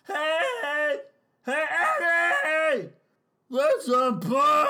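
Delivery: loud shout; loud call to Eddie. Real, not acted. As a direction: call across the room.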